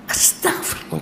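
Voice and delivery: breathless rushed whisper of astagfirullah; whispered istighfar. Retold or imitated. Preacher mocking rote prayer, whispered under breath. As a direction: murmur it half-heartedly under the breath.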